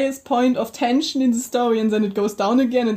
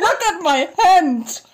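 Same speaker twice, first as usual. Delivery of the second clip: laughing protest; 6 semitones higher, slower than usual. Real, not acted. Laughing together over hurting hand.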